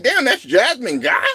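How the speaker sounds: high, incredulous question; stunned recognition. Retold or imitated. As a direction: gasp it like you can't believe who it is. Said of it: "I was like".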